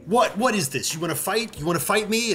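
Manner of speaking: high terrier voice daring you to fight; scrappy terrier challenge. Acted. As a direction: bristle like a small dog itching for a fight.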